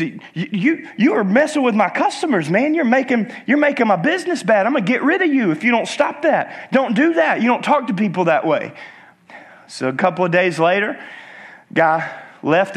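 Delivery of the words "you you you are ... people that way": higher-pitched mock telling-off; acted exasperated scolding; scolding shop owner. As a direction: tell off a misbehaving pet, finger pointed. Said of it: "He points his finger in his face".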